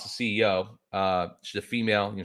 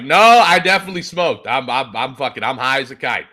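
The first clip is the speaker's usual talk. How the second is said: defensive protest; 5 semitones higher, 9 dB louder than usual. Retold or imitated. Defensively insisting he smoked.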